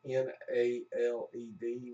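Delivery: normal talking voice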